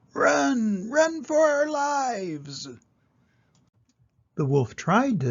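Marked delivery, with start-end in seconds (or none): 0.1-2.7 much higher, slow panicked call up; urgent call. Acted. Duck calling up to the wolf, high urgent call. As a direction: yell to flee, stretching it out. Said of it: "The duck called up".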